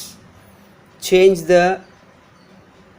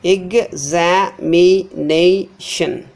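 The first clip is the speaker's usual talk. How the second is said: examination very slowly, ex...a...mi...na...tion; syllable by syllable; much slower than usual. Shown as a demonstration. Teacher breaking down the word, examination syllable by syllable. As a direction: separate each syllable of examination with pauses. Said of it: "nay is stressed".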